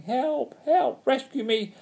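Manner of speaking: high-pitched frantic cries for rescue; stranded witch shrilly calling for help; shouting for help. A narrator's reading. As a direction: call out desperately, way up high. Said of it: "waving her arms and shouting".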